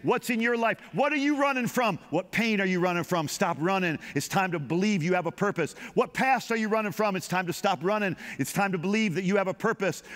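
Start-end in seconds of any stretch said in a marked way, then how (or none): none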